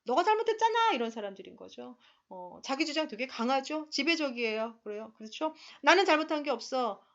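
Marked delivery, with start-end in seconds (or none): 0.1-0.9 sharp angry shout, higher and louder; blaming snap. Retold or imitated. Blaming type pointing at someone, angry yell. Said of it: "뭐 이런 사람들이에요". 5.8-7.0 blaming accusation. Retold or imitated. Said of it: "이런 거예요".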